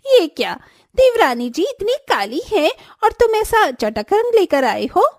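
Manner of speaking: high, sneering taunt. Acted. Swati taunting the younger sister-in-law, in a high sneer. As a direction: sneer at her, high-pitched.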